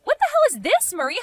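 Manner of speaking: sudden high, stunned exclamation; shocked outburst. Acted. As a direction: jolt up in pitch at the shock. [name] reads shocking messages.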